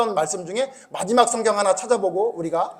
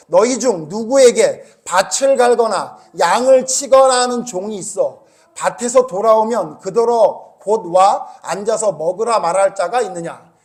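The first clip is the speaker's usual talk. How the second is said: slow, deliberate reading of scripture, a little louder; 6 dB louder, slower than usual. Acted. Pastor reads the Bible verse aloud to the congregation, slow and deliberate. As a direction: read the verse slowly to the congregation.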